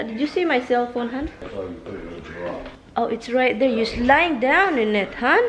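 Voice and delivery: loud call to someone far off; yelling across the room. Real, not acted. Calling to husband across room.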